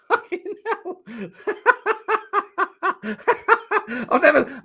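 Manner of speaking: laughing through the curse; real laughter. Real, not acted. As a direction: say it helpless with laughter. Laughing at a last-kick winner.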